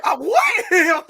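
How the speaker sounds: high, loud, confused yelp of a what; mock shouted what. Retelling the movie scene to friends, a high loud confused yelp. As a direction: blurt it out high and loud, playing dumb. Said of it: "He was like".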